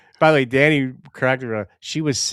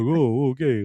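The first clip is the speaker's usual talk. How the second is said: put-on a light mumbling Magoo-like old man; mock doddering old man muttering; hint of a befuddled senator; Mister Magoo voice. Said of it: "Sounds like Mister Magoo".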